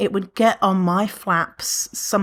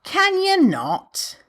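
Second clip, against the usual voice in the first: higher, slowed, drawn-out put-down; sassy retort; 10 semitones higher, much slower than usual. Shown as a demonstration. Snapping back at someone commenting on her body, slow and sassy. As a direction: drag it out with attitude.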